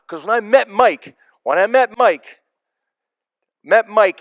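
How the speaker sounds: usual speaking voice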